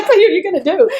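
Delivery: high, giggling through the words; laughing. Real, not acted. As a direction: laugh at your own story. Laughing while retelling the exchange.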